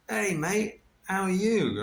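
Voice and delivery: high-pitched, cheery Cockney greeting; imitated Cockney twang, pitched well up; Gary's Cockney twang. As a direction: call it out like an old Cockney pal. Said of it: "with his sort of Cockney twang".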